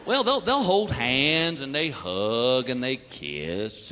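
low, slow and gentle; careful fatherly explaining. Retold or imitated. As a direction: ease into it like a nervous dad. Dad explains awkwardly to son.

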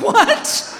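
squeaked high disbelief; high startled what. Retold or imitated. Hearing beer waits at the top, a startled high what. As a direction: react to beer on the mountain. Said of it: "I'm like".

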